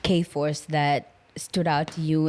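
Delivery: normal talking voice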